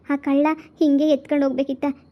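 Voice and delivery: normal talking voice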